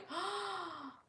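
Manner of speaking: a startled intake of breath; sharp gasp. Retold or imitated. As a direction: gasp like she just saw something. Sees his stare.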